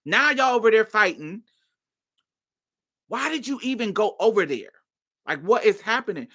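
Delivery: high-pitched, heated retelling of a telling-off; worked-up, high-pitched confrontation; exasperated scolding. Retold or imitated. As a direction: act out scolding a friend in disbelief. Acted confrontation with her friend.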